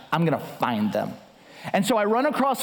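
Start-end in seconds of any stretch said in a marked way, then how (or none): 0.1-1.2 low, determined growl of a vow; grim vow. Retold or imitated. Husband vows to wife he'll catch culprits, low and grim. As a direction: say it deep down, deadly resolved.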